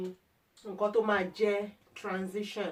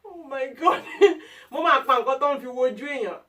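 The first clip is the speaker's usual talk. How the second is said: loud, high, laughing through the words; real fit of giggles, voice raised; 6 semitones higher, 9 dB louder than usual. Real, not acted. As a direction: crack up while trying to get the sentence out. Cracks up over her own wording.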